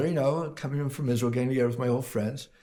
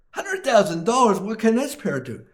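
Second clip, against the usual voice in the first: much higher, incredulous question; shocked customer; 8 semitones higher than usual. Acted. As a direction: sound floored by the price. Buyer shocked at parrot price.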